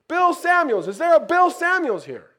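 loud high repeated shout; yelled call. Retold or imitated. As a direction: shout the name again to find him. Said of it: "yells out".

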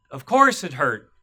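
snapping. A narrator's reading. Man snapping at the child about his mother's death. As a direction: throw the question back, then hit the answer hard. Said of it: "he snapped".

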